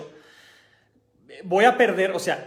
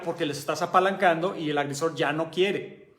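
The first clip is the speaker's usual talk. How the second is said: ordinary talk, nothing marked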